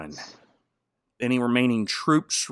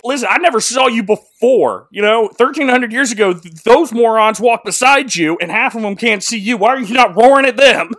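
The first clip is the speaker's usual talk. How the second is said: loud, higher, indignant rant; ranting Trumpkin; 7 semitones higher, 7 dB louder than usual. Retold or imitated. Trumpkin demands Aslan roar at others, loud indignant rant. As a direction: complain hard at the lion. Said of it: "And then Trumpkin's like".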